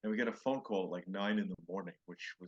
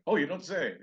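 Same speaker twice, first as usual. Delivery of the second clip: higher, louder fake-surprised host; hammed-up polite interest; mock Johnny reaction; 8 semitones higher, 8 dB louder than usual. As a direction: play the bemused talk show host. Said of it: "Johnny would be there going".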